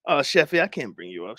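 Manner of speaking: ordinary delivery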